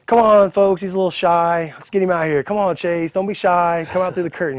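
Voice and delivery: imitating a host working the crowd; announcer coaxing. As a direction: call out to a shy guest. Promoter coaxing him out on mic.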